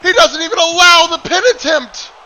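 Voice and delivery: erupting with excitement at the action; excited shout. Real, not acted. Commentator erupts at stolen finisher.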